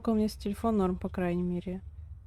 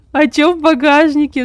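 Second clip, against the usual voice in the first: higher, louder surprised question; 5 semitones higher, 8 dB louder than usual. Real, not acted. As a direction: blurt it out in disbelief. Reacts to cat in trunk.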